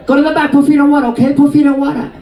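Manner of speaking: put-on high, quick Chinese-accented orders; put-on Chinese accent, pitched high; imitated Chinese accent. Nail lady sending client to the back, imitated Chinese accent. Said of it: "That's her accent".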